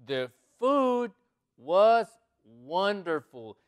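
loud and very slow, word by word; slow model reading. Shown as a demonstration. Teacher presenting a new English example, loud and slow. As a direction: project each word separately.

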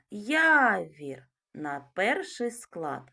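"Явір" called slowly, first syllable ЯВ drawn out; calling out the word. Shown as a demonstration. Teacher calling the word to show the stress falls on the first syllable. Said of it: "Давайте покличемо".

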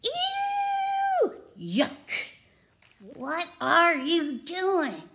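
high squealing Ew, then a slow disgusted question; disgusted mole rats. Acted. Mole rats shrieking in disgust at Wilbur's clothes. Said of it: "they said".

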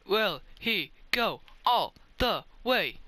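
slow, high, word-by-word build-up; mock announcer stretching out the question; suspenseful commentary. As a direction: space out every word for suspense. Playful commentary on own run.

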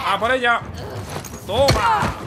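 high, loud battle cry; excited attack shout. Real, not acted. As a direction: cheer your own attack loudly. Ataca a un enemigo jugando. (grito eufórico de ataque)

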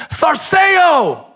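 high loud call; Jesus calling out. Acted. Jesus calling to the frightened disciples, loud and high. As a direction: shout it across the waves. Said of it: "Jesus spoke up and he said".

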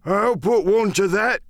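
put-on high-pitched old seaman's outburst; crying out. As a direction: butt in as an angry old sailor. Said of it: "cried the old mahogany-faced seaman".

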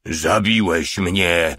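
usual speaking voice

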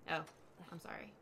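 ordinary talk, nothing marked

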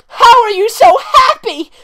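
very high, very loud, slow squeal; blurted squeak. Acted. Victoria blurting at the grinning boy, squealed falsetto. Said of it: "Victoria blurted out".

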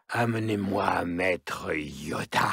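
normal talking voice

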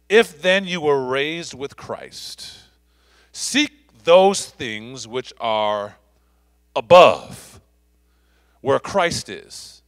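slow, emphatic pulpit reading; scripture reading. Real, not acted. Pastor reading Colossians to the congregation, slow and emphatic. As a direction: read it out slowly, word by weighty word.

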